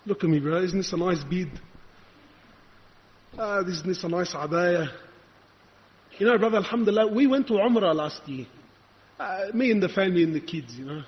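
understated mimicry of a laid-back brother; complacent brother. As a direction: play a comfortable believer, casually pleased with himself. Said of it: "you thought".

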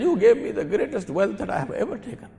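high, acted tearful gratitude; imitated weeping. Converted thief thanking his host, imitated tearfulness. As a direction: voice an overcome man, pitched well up. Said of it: "the man is weeping and crying".

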